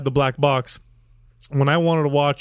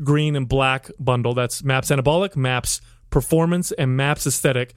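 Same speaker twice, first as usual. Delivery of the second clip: ordinary talk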